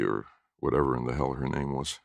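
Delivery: ordinary delivery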